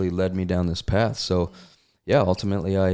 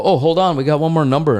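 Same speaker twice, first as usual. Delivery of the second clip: loud, high announcer call; host calling out; 8 semitones higher, 8 dB louder than usual. Retold or imitated. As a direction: grab the crowd back for one more. Host calling out a last number.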